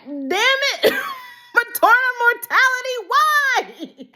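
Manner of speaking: high, laughing despair; laugh-cry at the plot; laughing outcry. Retold or imitated. Fan reliving her laughing outcry at the TV. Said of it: "And I'm like".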